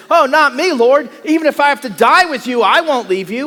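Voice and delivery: loud, high eager protest; Peter's protest. Acted. Peter insisting to Jesus he will never leave, loud and eager. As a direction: swear loyalty loudly.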